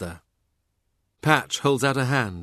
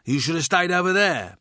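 fool's higher-pitched London voice; London street jeer, pitched up; London accent; 7 semitones higher than usual. A narrator's reading. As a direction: taunt him in a London accent. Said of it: "in his own London voice".

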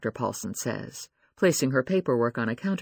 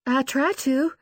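higher, shaky reply; 12 semitones higher, slower than usual. A narrator's reading. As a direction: let the voice tremble. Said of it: "she replies in a shaky voice".

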